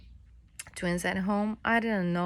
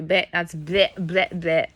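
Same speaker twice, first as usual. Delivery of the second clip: disgusted; 5 dB louder, slower than usual. Real, not acted. Reacting to diaper smell.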